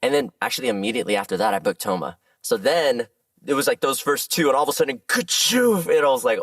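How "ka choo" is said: high, explosive "ka-choo"; spoken launch sound effect, pitched up; imitated whoosh. Actor telling how roles suddenly took off, explosive sound.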